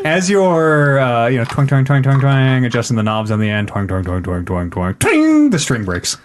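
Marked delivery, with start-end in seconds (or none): 1.5-5.5 imitated lute tuning. Game master mimics the bard's botched lute tuning, imitated twangs. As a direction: mimic plucked strings until one pings.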